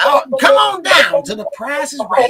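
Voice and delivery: high, loud game-show call; game show announcer. Pretend. Mimics game show call to caller.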